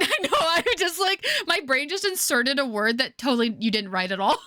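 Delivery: giggling at her own slip; laughing. Real, not acted.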